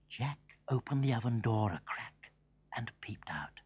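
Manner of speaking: usual speaking voice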